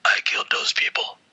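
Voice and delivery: raspy whispered Batman with a cold; hoarse stuffy whisper, like a bad cold.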